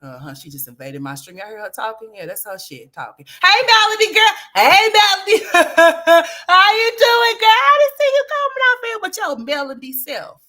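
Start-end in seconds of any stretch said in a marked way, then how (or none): 3.4-10.4 much higher and louder, gushing hello; excited greeting. Real, not acted. A streamer greets a viewer's little girl on camera, gushing.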